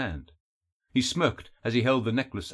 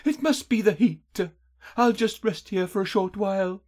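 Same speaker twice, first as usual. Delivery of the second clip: much higher, airy weary voice; high-voiced heroine worn out by heat; exhausted heroine's thought; 8 semitones higher than usual. A narrator's reading. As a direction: think aloud in a high, tired voice. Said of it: "She was so exhausted that".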